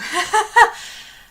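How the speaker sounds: loud, high burst of laughter; high delighted laugh. Real, not acted. Amazed by the app.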